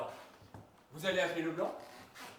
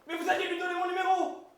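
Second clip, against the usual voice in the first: exasperated shout; 11 semitones higher, 8 dB louder than usual. Acted. Panicked anger at the caller.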